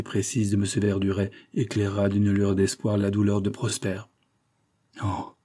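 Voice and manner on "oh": soft, suddenly calmed; murmured. A narrator's reading. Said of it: "murmura-t-il, soudainement calmé". (murmure apaisé)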